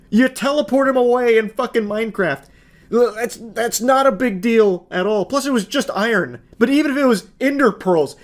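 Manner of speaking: exasperated rant. Real, not acted. To the audience about the iron fuss, exasperated outburst. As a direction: build it up, incredulous it's even an issue. Defending her against a petty boyfriend.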